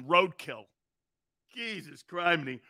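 ordinary talk

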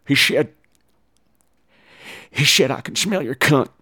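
halting, slurred-s imitation; Clarice. Clarice reporting to Lecter what was hissed, halting and soft. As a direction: answer quietly and haltingly with slushy s sounds. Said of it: "And Clarice is like".

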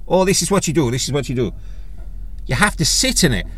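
put-on bossy little Irish-accented instruction. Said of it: "Apologies for the Irish accent".

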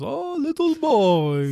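high, slow, mock menacing Russian; hammed-up villain croon; Rhino villain voice. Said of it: "and he goes".

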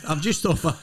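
ordinary talk, nothing marked

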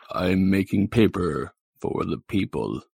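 godlike, deliberate declaration; Mister Bub. Acted. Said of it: "It's like God".

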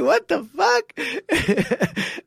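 very slow, high, loud incredulity; long loud high incredulous cry; drawn-out disbelief. Retold or imitated. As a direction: stretch every word in shock. Incredulous reaction repeated, stretched.

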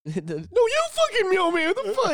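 very high, squeaky fake fury; hammed-up pitiful outburst; mock outraged squeal. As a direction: yelp it like a friend lashing out pitifully. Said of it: "Just like".

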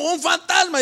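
high-pitched mock alarm; voicing the disciples. Disciples spot a ghost on the water, high-pitched. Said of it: "de repente se dieron cuenta y dijeron".

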